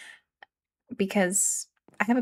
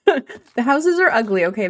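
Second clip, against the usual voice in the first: laughing; 3 semitones higher, 5 dB louder than usual. Real, not acted. Laughing about her ugly houses.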